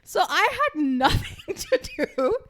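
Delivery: laughing. Real, not acted. Laughing at own situation.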